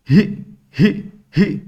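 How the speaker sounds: put-on short separate "ہی، ہی، ہی", childlike; lisping baby-talk sound. Shown as a demonstration. Teacher blending letter and vowel for a child, lisped "ہی". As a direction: break "ہی" into little lisped bursts. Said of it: "ہا کو توتلی زبان میں".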